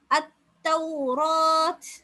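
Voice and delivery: அத்தௌராத் very slow, with the last ா stretched; slow recitation. Shown as a demonstration. Teacher intoning the example word for students. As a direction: chant அத்தௌராத் slowly. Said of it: "சின்ன அலிஃபை வச்சு தான் நாங்க நீட்டுறோம்".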